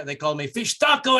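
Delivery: usual speaking voice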